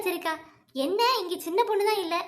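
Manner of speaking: ordinary talk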